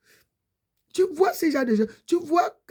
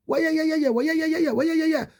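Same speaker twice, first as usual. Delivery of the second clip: imitated baby wail; 6 semitones higher than usual. Preacher mimics a hungry baby crying during the fast, mock wail. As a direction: wail like a fussy baby, hammed-up.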